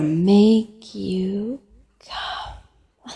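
drawn-out, deliberate promise; slow teasing. Acted. Caller repeating a promise to tease Jack, slowly. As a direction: stretch each word out slowly.

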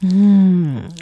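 ordinary delivery

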